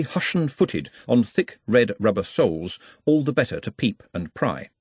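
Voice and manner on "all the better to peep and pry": ordinary delivery, nothing marked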